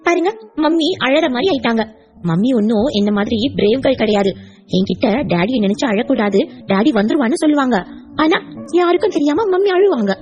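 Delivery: a hint of a child chiding her grandpa; mild childlike reproach; lightly voiced little girl.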